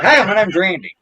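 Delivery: high-pitched backwoods cousin introducing himself; Randy Rutledge. Retold or imitated. Man imitates his cousin saying his name, country character voice. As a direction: pitch it up in a hillbilly hello. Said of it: "he'll tell you, he's like".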